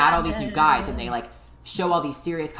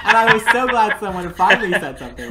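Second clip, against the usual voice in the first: laughing; 6 semitones higher, 8 dB louder than usual. Real, not acted. Laughing at a reality show moment.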